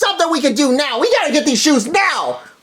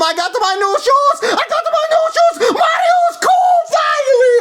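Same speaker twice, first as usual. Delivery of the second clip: gleeful cheer; 11 semitones higher than usual. Acted. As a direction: crow about the new shoes. Mario celebrating new shoes.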